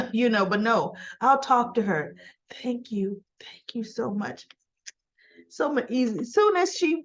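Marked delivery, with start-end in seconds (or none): 2.6-4.5 hushed, imitated gratitude; whispered thanks. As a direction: drop into a near-whisper to act out thanks. Acting out grateful reply to Kyle.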